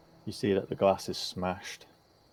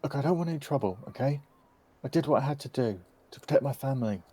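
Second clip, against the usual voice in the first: put-on higher-pitched, pleading young man; intimidated young suspect; 6 semitones higher, faster than usual. Young man pleading with armed questioners to avoid trouble, defensive.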